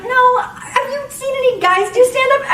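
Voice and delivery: high, drawn-out mock protest; indignant retort. Comic rebutting the vagina-comic label, indignant. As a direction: push back with a loud, high objection.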